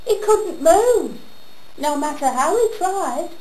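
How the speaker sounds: ordinary talk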